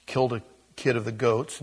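normal talking voice

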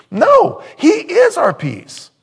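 very high, loud and slow; climactic shout. Real, not acted. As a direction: proclaim it at full force. Sermon climax.

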